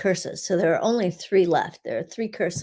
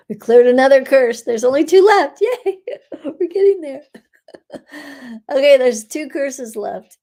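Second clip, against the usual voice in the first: giggling, pleased and louder; laughing delight; 6 semitones higher, 9 dB louder than usual. Real, not acted. Healer laughing with listeners as a curse clears. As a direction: bubble over with laughter at the progress.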